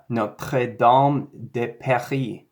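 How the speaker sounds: a hint of a French accent on "Notre Dame de Paris". Shown as a demonstration. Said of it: "try it in a bit of a French ac-, accent".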